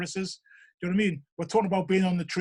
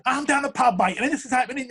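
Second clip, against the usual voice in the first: higher, louder football-fan banter; British pub lad; 5 semitones higher, 4 dB louder than usual. Retold or imitated. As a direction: imitate a lad rapping about the pub. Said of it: "He's talking about football".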